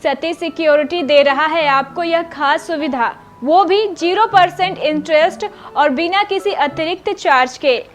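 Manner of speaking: loud, punchy ad read; hard sell ad. Acted. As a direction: push the offer like a radio ad. Anchor reads ad over music.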